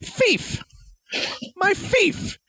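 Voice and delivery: put-on very high little-kid voice; tiny child's high-pitched cry; four-year-old shouting. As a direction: squeal it like a toddler. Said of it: "my four-year-old looks at me and goes".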